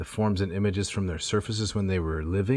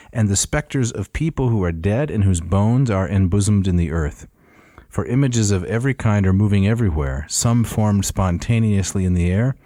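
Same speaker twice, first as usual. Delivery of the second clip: reading aloud; 6 dB louder than usual. Acted. Reads the translation aloud.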